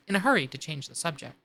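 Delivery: plain reading voice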